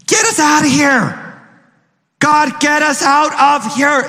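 high, pleading cry, get us out of here; desperate cry for help. Retold or imitated. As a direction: cry out to God from slavery, high and urgent. Slaves crying out to God.

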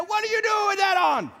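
loud, high scolding shout; wife scolding. Retold or imitated. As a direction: scold him about the outfit. Wife scolding husband's outfit.

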